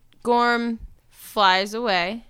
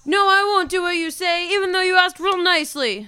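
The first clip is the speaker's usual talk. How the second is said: mocking; 10 semitones higher than usual. Mocking reply to the dragon.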